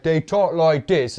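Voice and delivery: put-on Peaky Blinders accent. Showing his co-host how the characters talk, in mock thick accent. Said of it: "that is how they talk".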